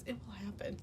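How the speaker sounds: whisper. Pretend. Whispering karma mantra.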